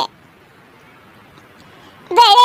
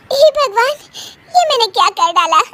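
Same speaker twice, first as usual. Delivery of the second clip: higher fretting broken by helpless giggles; worried words leaking laughter; dismay with giggles; 4 semitones higher than usual. Acted. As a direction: regret it while unable to stop laughing. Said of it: "हंसने वाली दवा खा लेती है".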